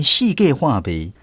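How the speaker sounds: usual speaking voice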